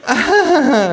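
high, loud fake laugh; artificial laugh. A narrator's reading. Hostess covering awkwardness, artificial laugh. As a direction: laugh falsely in a piercing pitch. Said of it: "Lacht die Frau künstlich".

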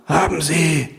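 high, strained death rattle; gurgling, mortally wounded pollster; dying rasp. A narrator's reading. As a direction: wheeze the question with a last breath. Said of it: "röchelt einer".

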